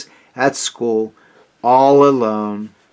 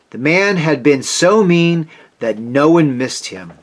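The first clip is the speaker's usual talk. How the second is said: usual speaking voice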